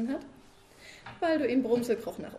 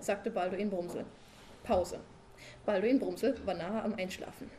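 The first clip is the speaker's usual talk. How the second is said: plain reading voice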